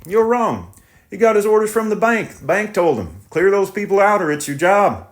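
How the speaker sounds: defensive and pleading; tractor driver voice. Acted. Driver explains the bank.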